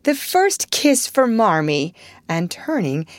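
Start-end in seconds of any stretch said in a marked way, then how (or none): 0.0-1.9 joyful cry. A narrator's reading. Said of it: "Meg cried".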